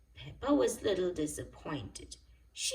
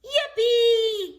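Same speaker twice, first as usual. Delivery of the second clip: high, loud shout of joy; delighted cry; 12 dB louder than usual. A narrator's reading. Peppa cheers as the car sets off, high loud yippee. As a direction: squeal it with pure delight. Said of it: "cried Peppa".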